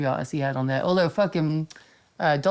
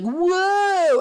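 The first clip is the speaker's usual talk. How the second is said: mock whoa; 12 semitones higher, 4 dB louder than usual. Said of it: "he was like".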